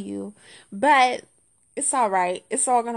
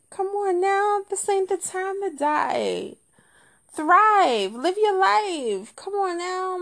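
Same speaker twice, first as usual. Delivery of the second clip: higher, drawn-out mock plea; exasperated pleading; 7 semitones higher, slower than usual. Comedian begging a dead gadget to live, exasperated pleading. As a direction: plead melodramatically, stretching the words.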